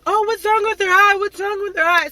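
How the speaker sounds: high, loud mock-concerned question; mock viewer voice. Viewers gawking at her eye, mock concerned. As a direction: play a nosy viewer gasping at her eye.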